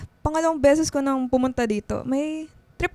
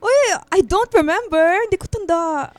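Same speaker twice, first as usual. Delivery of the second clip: loud, high, laughing protest; flustered denial; 5 semitones higher, 6 dB louder than usual. Acted. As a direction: deny it, all embarrassed. Flustered denial after teasing. (nahihiyang pagtanggi, malakas)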